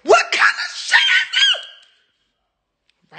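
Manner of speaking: high shriek. Real, not acted. Distraught over broken glasses.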